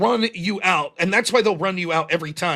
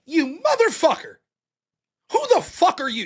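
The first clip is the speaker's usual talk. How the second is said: retold furious retort, pitched up; livid outburst; 7 semitones higher than usual. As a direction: snap it back like you're livid at the message. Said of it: "I was fucking livid".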